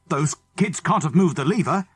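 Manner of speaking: agitated. Acted. Mister Andrews arguing, agitated.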